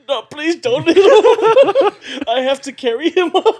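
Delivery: high, loud plea through laughter; laughing mock plea. Friend begging Raymond not to hit him, retold through laughter. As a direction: beg while cracking up, words stretched out.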